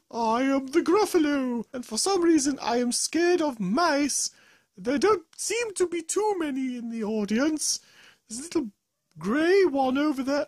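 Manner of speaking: high, loud monster voice; Gruffalo voice. Acted. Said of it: "Third up we have the Gruffalo".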